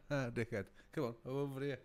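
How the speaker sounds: ordinary delivery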